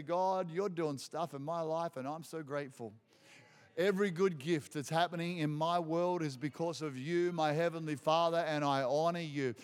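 hushed thanks to the Father; a quiet, earnest prayer of gratitude; grateful prayer. Shown as a demonstration. Modelling a humble prayer.

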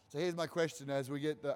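normal talking voice

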